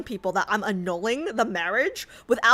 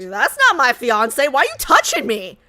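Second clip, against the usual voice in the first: alarmed outrage; 3 semitones higher, 8 dB louder than usual. Real, not acted. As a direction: recoil from a stranger's touch. Queen alarmed at stranger.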